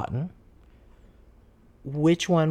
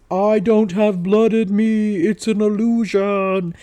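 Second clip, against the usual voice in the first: loud, higher, drawn-out mock mosquito language; Mosquitoese; 5 semitones higher, 6 dB louder, slower than usual. Fooling mosquitoes with a necklace, mock Mosquitoese. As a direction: talk to the bugs in slow mock Mosquitoese. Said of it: "it speaks Mosquitoese and it says".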